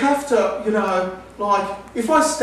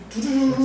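higher chanted dun dun dun; chanted dun beats; 8 semitones higher than usual. Retold or imitated. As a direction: sing out the beat like an orchestra cue. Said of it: "it's like it goes".

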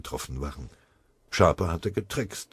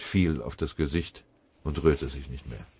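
plain reading voice

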